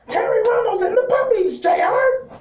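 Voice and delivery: mock commentator squeal, very high-pitched; Jerry Lawler. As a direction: imitate a giddy ringside announcer squealing. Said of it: "all Jerry could talk about was".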